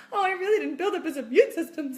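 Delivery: imitating her own worried fussing; mock guilt. Mocking her own guilty worry.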